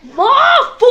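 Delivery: high, loud, long-held battle cry; attack-name shout. Retold or imitated. Roshi unleashes his sealing technique, long hammed-up shout. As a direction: bellow the technique and stretch it out.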